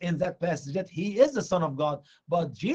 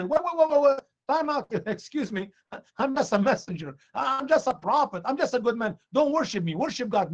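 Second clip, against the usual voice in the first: higher, hurried hammed-up objection; mock protest; 6 semitones higher than usual. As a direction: wave off the worship in mock alarm. Imagined Jesus hastily refusing worship.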